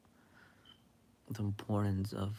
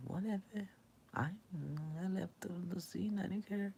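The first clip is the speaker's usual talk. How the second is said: much higher, airy not-caring voice; carefree brush-off; 9 semitones higher, 4 dB quieter than usual. Retold or imitated. Herself after leaving the argument, high airy carefree tone. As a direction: float above it, nothing bothers you.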